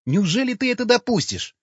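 very high, incredulous protest; appalled appeal. A narrator's reading. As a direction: plead in outrage, pitch right up. (возмущённо, высоким голосом)

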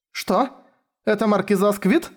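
high astonished question; surprised. A narrator's reading. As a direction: gasp it out in disbelief. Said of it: "округлила глаза Шура". (удивлённо, высоким голосом)

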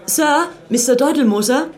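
calling out. Acted. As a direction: call out high and loud across the room. Ruft den Kellner herbei. (laut und hoch rufend, um den Kellner herbeizuholen)

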